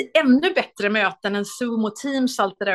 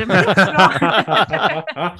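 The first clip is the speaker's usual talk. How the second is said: laughing; 3 semitones lower, 7 dB louder, much slower than usual. Real, not acted. Laughing punchline.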